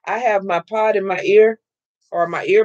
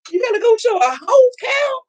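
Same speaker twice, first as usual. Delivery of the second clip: mock incredulous shout; 14 semitones higher, faster than usual. Re-enacts her own astonished reaction.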